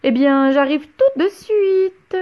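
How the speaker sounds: giggly eager witch; mock cackling delight; clucking giggle. A narrator's reading. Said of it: "gloussa-t-elle".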